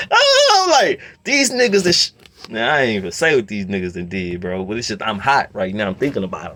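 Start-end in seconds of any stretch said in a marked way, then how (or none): 0.1-2.1 laughing. Real, not acted. Laughing, mocking them.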